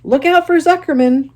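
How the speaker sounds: high, loud gander warning; the gander warns Wilbur, high and loud; gander yelling. Acted. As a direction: yell the warning. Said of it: "yelled the gander".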